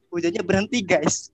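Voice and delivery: exasperated punchline. Real, not acted. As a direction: deliver the ironic twist with a laughing groan. Punchline: rain stopped after buying. (nada kesal bercanda, lebih keras)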